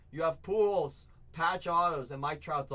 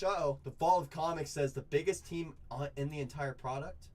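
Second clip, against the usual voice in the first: ordinary talk, nothing marked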